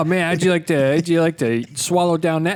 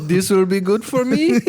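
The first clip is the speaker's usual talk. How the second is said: mock Russian accent; 4 semitones higher than usual. Friends mocking Russian movie accent.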